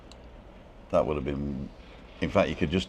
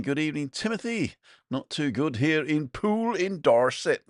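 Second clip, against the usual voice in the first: a light hint of a Dorset accent, pitched up; 8 semitones higher, 3 dB louder than usual. Reading Derek's greeting to viewers, a light Dorset accent. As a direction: read the greeting in a mild Dorset burr. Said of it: "was that a Dorset accent I did there".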